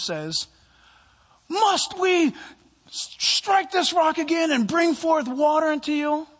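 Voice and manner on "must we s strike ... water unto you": high, loud, slow put-upon Moses voice; high, loud, slow and weary Moses; exasperated Moses. Acted. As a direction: play Moses fed up and scolding the people.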